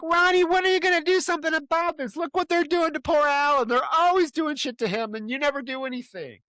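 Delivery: high, indignant scolding; high-pitched nagging complaint; scolding camp woman. Retold or imitated. Recapping a woman nagging Ronnie about Alan, high indignant scold.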